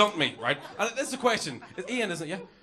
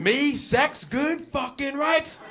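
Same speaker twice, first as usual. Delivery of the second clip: higher, very slow swaggering boast; cocky swagger; 7 semitones higher, 5 dB louder, much slower than usual. Retold or imitated. Young lad strutting about, a high slow swaggering boast. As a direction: strut through each word. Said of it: "Walking around going".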